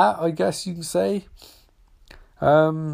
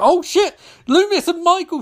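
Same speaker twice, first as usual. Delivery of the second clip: sudden higher, louder oh shit; surprised shout; 11 semitones higher, 4 dB louder than usual. Real, not acted. Watching the movie, caught off guard by the showdown, surprised shout. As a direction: jolt up at the screen.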